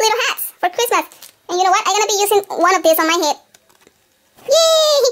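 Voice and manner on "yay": excited cheer. Real, not acted. Cheering over Christmas hats.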